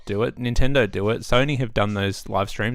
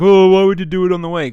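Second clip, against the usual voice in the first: mocking quote; 5 semitones higher, 9 dB louder than usual. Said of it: "a lot of people are like".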